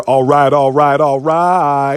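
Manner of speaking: held-out, chanted catchphrase in a put-on voice; Kevin Hart impression. Recalling a famous comedian's joke everyone remembers, catchphrase impression. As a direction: imitate a comedian's famous repeated bit. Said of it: "even if you don't like Kevin Hart".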